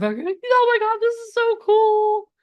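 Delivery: very high starstruck squeal; hammed-up giddy excitement; so emotional. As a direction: gush like an overwhelmed fan, stretching the end. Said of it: "you're gonna get so emotional".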